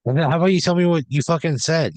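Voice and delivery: indignant demand. Acted. Player confronting an evasive psychic, loud and indignant. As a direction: demand an answer, raising your voice.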